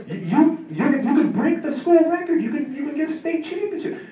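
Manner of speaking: high, loud, strained coach's yell, laughing through it; a coach screaming at an athlete to stick with track; imitated screaming coach. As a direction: bellow at the kid like a furious coach. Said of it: "This is what he's screaming at me".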